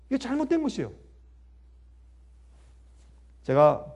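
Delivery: ordinary talk, nothing marked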